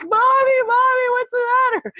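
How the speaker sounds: high-pitched mock child panic; imitating scared child. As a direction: cry out like a frightened little girl. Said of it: "girls are scared out of their wits".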